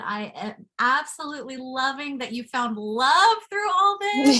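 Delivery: building to a high, loud gush; excited, so happy. Real, not acted. Co-host celebrating that the guest found love, excited. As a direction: let delight burst out as you go. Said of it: "I'm so excited".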